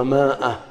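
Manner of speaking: "مائة" said as maa-a with a long aa; common mispronunciation. Shown as a demonstration. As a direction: read the written alif in "مائة" aloud. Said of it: "وبعضهم ينطقها".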